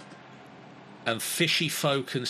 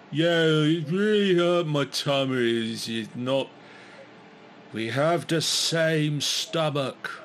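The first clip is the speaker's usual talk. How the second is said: slow dopey head voice; 4 semitones higher, 3 dB louder than usual. Acted. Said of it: "the first head".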